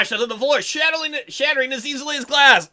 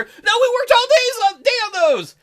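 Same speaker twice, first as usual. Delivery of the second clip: much higher, louder cry of dismay; Santa girl dismay; 13 semitones higher, 5 dB louder than usual. Acted. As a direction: wail as the girl sees the toys break. Santa crying out.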